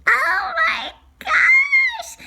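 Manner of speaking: very high laughing shriek; squealing alarm. Acted. Hearing the alligator eats toes, squealing laughing alarm.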